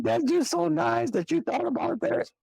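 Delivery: high imitation of a tearful young woman; imitating the girlfriend. Cousin's girlfriend quoted crying.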